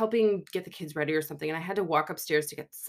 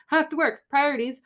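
higher, louder mock-insistent protest; retold insistence; 9 semitones higher, 5 dB louder, faster than usual. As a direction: push back with raised pitch and volume. Firmly refusing hospital on phone.

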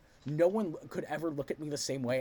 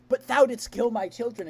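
mock-Shakespearean lament; 5 semitones higher, 6 dB louder than usual. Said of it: "she's like".

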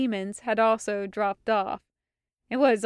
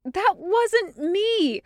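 high, slow protest of innocence; slow, pitched-up denial; 10 semitones higher, 4 dB louder, much slower than usual. A narrator's reading. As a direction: insist it wasn't you. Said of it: "Hawk protested".

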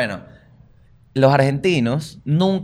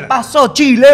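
venezuelan slang greeting; 12 semitones higher, 8 dB louder than usual. Retold or imitated. Imitando saludo venezolano.

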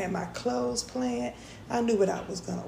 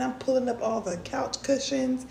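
normal talking voice

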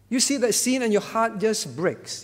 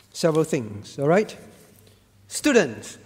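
usual speaking voice

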